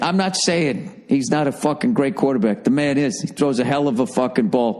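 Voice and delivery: normal talking voice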